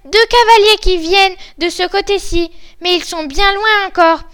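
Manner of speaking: high, loud report; excited lookout. Acted. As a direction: call down urgent news from a height.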